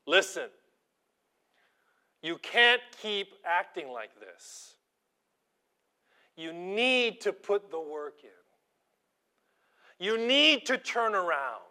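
teacher losing his temper, hammed-up; stern warning. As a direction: lay down the law to the slacking student. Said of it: "I had to give him a warning".

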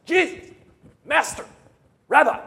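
high, loud frantic cries to wake him; panicked calling out. Acted. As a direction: shout his names in alarm, fast. Disciples waking Jesus in storm.

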